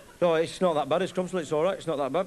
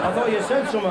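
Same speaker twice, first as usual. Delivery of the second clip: ordinary delivery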